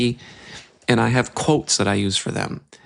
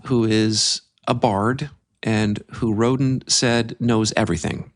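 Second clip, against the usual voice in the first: ordinary talk, nothing marked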